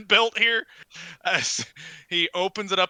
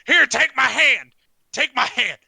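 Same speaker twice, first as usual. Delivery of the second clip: high, loud, insistent shout; urgent offer; 7 semitones higher, 9 dB louder than usual. Acted. One-handed man offers his hand, shouting.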